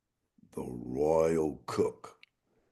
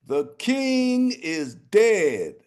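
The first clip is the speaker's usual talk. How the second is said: crowing proclamation; 14 semitones higher, 4 dB louder, much slower than usual. Acted. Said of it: "repeated proclamation".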